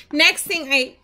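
hiccuping mid-sentence; hiccups. Real, not acted. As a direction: talk through the hiccups.

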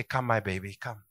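a gentle, soft offer of help; tender. Retold or imitated. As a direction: soothe them like a baby. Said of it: "and then you say".

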